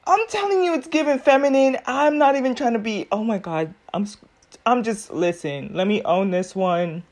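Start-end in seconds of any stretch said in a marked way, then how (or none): none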